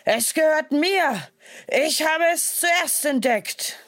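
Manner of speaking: tiger roars his claim at the cave; thundering tiger. A narrator's reading. Tiger keeps demanding the child.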